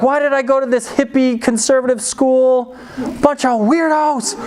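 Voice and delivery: put-on high, indignant kid rant; hammed-up whiny anger; mock angry. Kid fuming about his school on getting home, high mock anger. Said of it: "I'm kinda angry about it".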